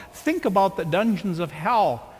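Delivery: usual speaking voice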